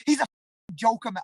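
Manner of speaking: high-pitched put-on American accent. Fighter mocking guys who badmouth opponents, in an American accent. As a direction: slip into an American voice. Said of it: "I don't know why I'm talking American there".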